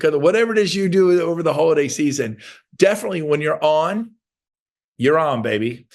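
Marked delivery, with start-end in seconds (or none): none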